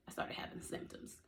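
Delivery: whispered, hushed for emphasis. Shown as a demonstration. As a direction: breathe it out as a secret whisper. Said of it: "And when I say".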